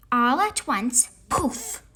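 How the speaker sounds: ordinary delivery, nothing marked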